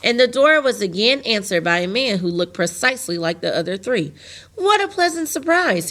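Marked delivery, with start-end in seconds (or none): 4.6-5.9 high, delighted cry; light up at the surprise visitors; cried happily. A narrator's reading. Lonely man greeting unexpected visitors, crying happily. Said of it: "he cried happily".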